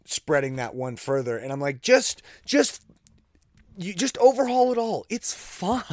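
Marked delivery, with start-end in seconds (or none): 1.8-5.8 high-pitched exasperated plea. Retold or imitated. Fan telling the league to fix the rules, exasperated. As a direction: plead in a high voice, half laughing at the end.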